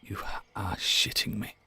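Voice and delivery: breathy stunned whisper; whispered disbelief. Pretend. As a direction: whisper it in shock. Stunned at ten-year sentence.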